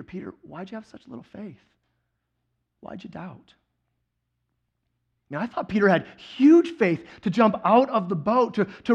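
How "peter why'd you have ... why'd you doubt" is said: much softer, hushed questioning; gentle question. Acted. Jesus to rescued Peter in the boat, gentle quiet question. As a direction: ask it softly, without scolding.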